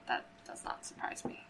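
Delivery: dry low mutter. Acted. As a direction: mutter it under your breath. Guest muttering aside.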